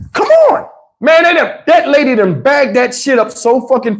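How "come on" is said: a sharp, very high hurry-up; high impatient call. Retold or imitated. Tony rushing Steve at the register, high impatient call. Tony urging him at checkout.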